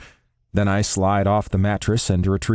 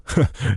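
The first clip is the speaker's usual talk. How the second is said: short dry laugh; wry laugh; 5 semitones higher than usual. A narrator's reading. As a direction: laugh wryly once. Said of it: "I let out a wry laugh".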